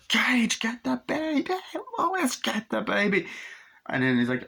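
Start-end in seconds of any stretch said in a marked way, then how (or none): 0.1-3.3 very high frantic call; panicked shout. Retold or imitated. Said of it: "Lewis like".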